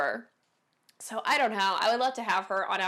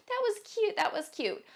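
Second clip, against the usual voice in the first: catty mock praise; 12 semitones higher than usual. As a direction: coo it mockingly like a catty girl.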